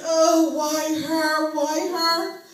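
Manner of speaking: loud, slow mourning wail; mock wailing. Mourners at the casket, loud slow wailing. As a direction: drag out the funeral wail. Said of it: "they yelled and screamed".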